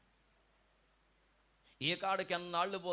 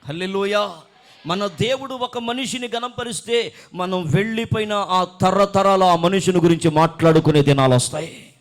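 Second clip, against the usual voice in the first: loud sermon proclamation; full-force preaching; 2 semitones higher, 15 dB louder than usual. Real, not acted. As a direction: drive it out loudly to the congregation. (బిగ్గరగా ప్రసంగ ప్రకటన)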